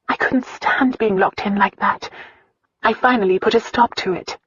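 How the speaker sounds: put-on a hurt girl finally opening up; Christina's voice.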